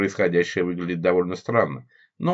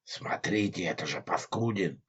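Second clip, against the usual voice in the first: sepulchral voice; 8 dB quieter than usual. A narrator's reading. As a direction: speak quietly like a ghost. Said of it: "загробным голосом произнёс". (загробный голос)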